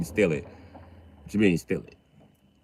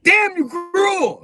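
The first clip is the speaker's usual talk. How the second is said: mock outrage; 3 dB louder, much slower than usual. People react to Leos.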